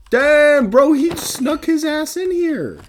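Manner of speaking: high, loud, slowed mock voice of the drunk friend; mock squeaky friend. Customer's friend quoted in story.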